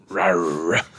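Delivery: playful mock tiger roar; growling tiger voice. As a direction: give a little growly rawr. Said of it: "That was a Bengal Tiger".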